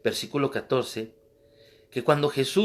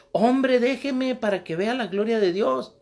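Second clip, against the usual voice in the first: put-on high, pressing old lady voice; insistent old woman; 9 semitones higher, 4 dB louder than usual. Old woman to the young man, high and insistent. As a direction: insist she'll show God's glory.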